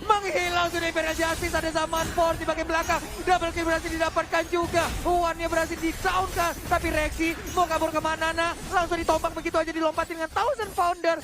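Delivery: loud, rising esports play-by-play; fighting game announcer. Real, not acted. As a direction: call the teamfight at full hype. (komentator esports berteriak heboh)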